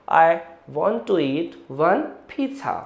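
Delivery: slow, soft model sentence; slow demo sentence. Shown as a demonstration. Teacher reading a slow soft example to students. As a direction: read it slowly and gently. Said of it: "तेजी से बोल दीजिए".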